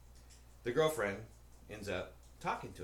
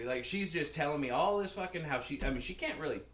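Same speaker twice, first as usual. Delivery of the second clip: normal talking voice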